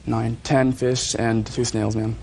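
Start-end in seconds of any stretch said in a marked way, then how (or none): none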